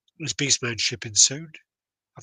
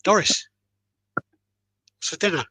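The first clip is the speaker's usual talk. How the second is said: higher, louder call across the room; 9 semitones higher, 6 dB louder, slower than usual. Retold or imitated. Husband calls loudly to his wife across the house. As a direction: shout it over twenty feet.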